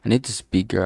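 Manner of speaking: usual speaking voice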